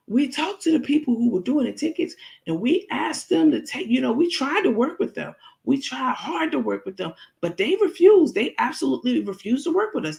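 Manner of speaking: high, sweet fake-earnest voice; lying Crook. Crook on camera claiming she tried, high fake sincerity. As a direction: put on a high innocent tone while lying. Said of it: "She said".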